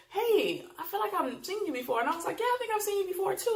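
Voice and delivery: high, quick mock chatter; breezy imitation of both sides; lightly voiced party greetings; retold small talk. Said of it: "she goes".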